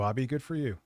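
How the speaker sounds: ordinary delivery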